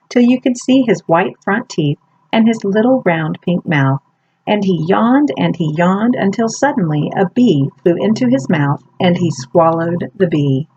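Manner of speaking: plain reading voice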